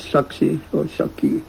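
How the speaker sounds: Shakshi or Shakti said the North Indian way; North Indian pronunciation. Shown as a demonstration. Teacher showing class the North Indian pronunciation. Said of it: "us North Indians".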